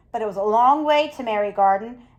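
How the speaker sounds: ordinary delivery, nothing marked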